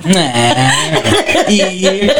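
put-on playful Res accent, laughing. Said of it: "get my Res accent on".

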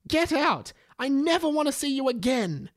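high, strained scolding yell; a hammed-up teacher screaming at a late pupil. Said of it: "Mister Charger screamed".

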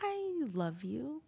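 I love you stretched very slow; slow drawn-out. Shown as a demonstration. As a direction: draw out each word of I love you. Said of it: "you can say a sentence a hundred ways".

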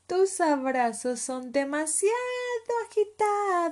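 high, loud cub protest rising to a long wail; shouted little leopard voice; yelled complaint. Acted. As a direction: shaken cub crying out. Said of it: "gritó Leopardito".

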